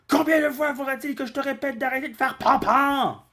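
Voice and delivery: put-on high, loud, exasperated old man scolding. Grandpa scolding Goku yet again, in a high loud exasperated voice.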